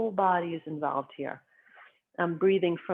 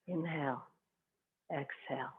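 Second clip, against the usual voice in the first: very soft, fading to near silence; a soft breath cue that fades almost to nothing; hushed breath cue; 3 semitones lower, 13 dB quieter than usual. Shown as a demonstration. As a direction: breathe the words out quietly.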